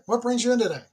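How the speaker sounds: high, bright doctor's greeting; friendly physician opening. Acted. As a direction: welcome the patient in. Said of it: "leadership as a medical appointment".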